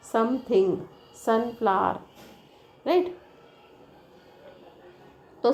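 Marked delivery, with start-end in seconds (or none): none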